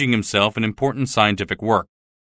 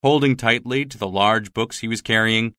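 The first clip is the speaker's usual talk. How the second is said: ordinary delivery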